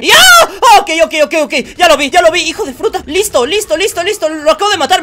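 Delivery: very high, loud scream, then flustered shouting; real panic in the street; panicked yelling. Real, not acted. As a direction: scream as a car nearly hits him. Nearly run over in the street.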